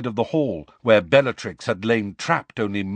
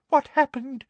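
whimper; 14 semitones higher than usual. Acted. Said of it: "he added in a kind of whimper".

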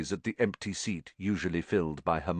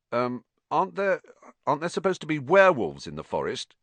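mildly nervous, higher hesitant question; 7 semitones higher, faster than usual. A narrator's reading. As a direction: stumble into a worried question, pitched up. Ron nervously asks about werewolves.

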